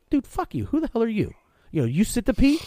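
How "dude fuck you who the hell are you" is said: much higher, indignant snap back; mock angry retort. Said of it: "I'm gonna go".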